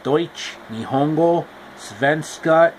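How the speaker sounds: usual speaking voice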